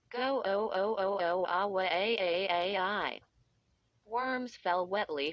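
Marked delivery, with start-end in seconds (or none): 0.1-3.2 put-on raspy, crackling old-record voice; scratchy recorded voice. A narrator's reading. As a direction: rasp it like a worn phonograph. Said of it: "It sounded like a crackling, Old phonograph record".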